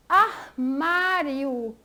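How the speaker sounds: "armário" very slow, stretching the MÁ; stress on penultimate syllable. Shown as a demonstration. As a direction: draw out "armário" for a class, leaning on MÁ. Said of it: "penúltima".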